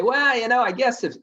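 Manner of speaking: high, loud, drawn-in buyer musing; acted wavering, pitched well up; tentative reconsidering. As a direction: play a prospect conceding a possibility. Acted buyer warming to the idea.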